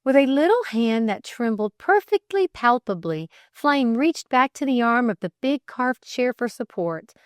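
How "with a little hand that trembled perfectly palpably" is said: plain reading voice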